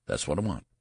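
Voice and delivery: ordinary delivery, nothing marked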